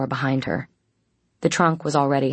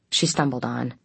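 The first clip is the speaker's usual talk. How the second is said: plain reading voice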